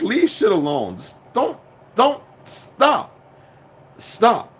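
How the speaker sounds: fed up, begging them to stop; exasperated. Real, not acted. Ranting at people who misuse the word, exasperated.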